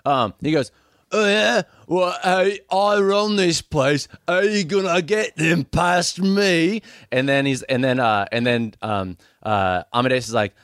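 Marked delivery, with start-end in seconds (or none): 1.1-6.8 defiant bully, slow. Acted. Beefy defies Amadeus.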